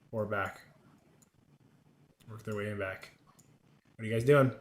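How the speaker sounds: usual speaking voice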